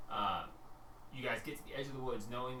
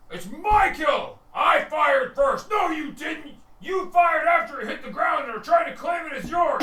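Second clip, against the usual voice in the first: sustained high, loud yelling; squabbling orcs at full volume; angry orc argument; 14 semitones higher, 15 dB louder, slower than usual. Acted. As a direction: bellow accusations back and forth. Two orcs argue over kill.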